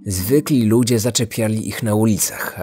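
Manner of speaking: ordinary delivery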